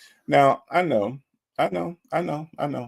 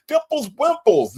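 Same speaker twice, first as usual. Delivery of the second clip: hype shout-out; 4 dB louder than usual. Real, not acted. Hyping up a chat member's name.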